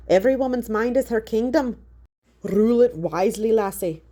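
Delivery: lightly Irish, fond advice; a hint of an old Irish farmer counselling; Irish grandfather. A narrator's reading. Great-grandfather advises Haley, light Irish brogue.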